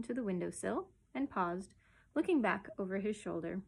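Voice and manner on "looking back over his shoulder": plain reading voice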